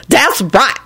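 high, mildly huffy woman; sniffy. A narrator's reading. Woman rebuking the excited boy, sniffy. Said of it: "Lucretia sniffed and gave Timothy a dark look".

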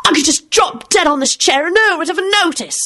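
high-pitched, understated tantrum yell; yelled complaint. A narrator's reading. Timothy jumping up on his chair, yelling. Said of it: "yelled Timothy".

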